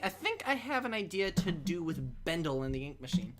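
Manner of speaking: normal talking voice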